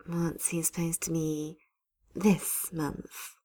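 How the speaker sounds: breathy hushed whisper. Acted. Sally asking about the calendar boy, whispered. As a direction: lean in and whisper the gossip.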